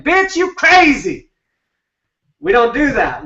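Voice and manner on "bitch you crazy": high, slow mock outburst at the girlfriend; a boyfriend losing it at his partner, high and slow; mock freak-out. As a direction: play a freaked-out guy snapping at her, pitched up and dragged out. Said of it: "freaking out and saying".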